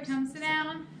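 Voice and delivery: usual speaking voice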